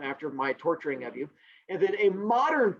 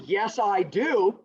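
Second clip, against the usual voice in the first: ordinary delivery, nothing marked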